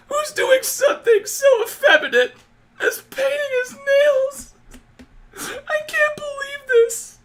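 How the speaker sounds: high, wailing mock sob of a fragile fan; mocking whiny fan. Mocking an anti-Caleb fan with a hammed-up high wailing sob. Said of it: "You sound like a complainer".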